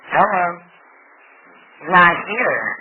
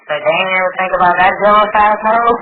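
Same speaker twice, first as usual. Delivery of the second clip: louder, heated and fed up; loud indignant rant; 4 semitones higher, 12 dB louder than usual. Real, not acted. Mother venting loudly about Facebook gossip, indignant. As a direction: spit out the outrage. Angry retelling of Facebook talk.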